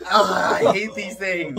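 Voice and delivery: loud, slow pained groan; sour candy grimace. Real, not acted. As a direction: drag it out in disgust.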